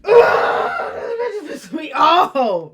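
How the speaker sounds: exasperated loud groan then a laughing shout; fed-up shout with a laugh. Real, not acted. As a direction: shout it out, half laughing in disbelief. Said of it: "This bitch is really pissing me off".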